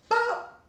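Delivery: a sharp, high cartoon "bop" for a bone snapping; comic snap sound in the middle of a story; pop sound effect. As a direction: spit out a quick high pop noise. Imitating the foot snapping.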